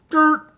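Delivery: short, loud high-pitched honk; saxophone toot. Pretend. Saxophonist getting ready then playing, one high honk.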